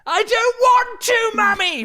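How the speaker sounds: very high, loud, drawn-out mock wail; child's tantrum shout. Boy shouting at mum by the meerkats, high mock tantrum. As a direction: imitate a brat yelling after his mum. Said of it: "shouting at her that he didn't".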